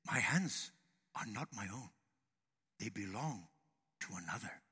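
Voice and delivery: very soft, low, halting sage; wise Chinese man. Acted. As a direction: let each phrase sink into a hush. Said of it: "it sounds so phenomenally wise".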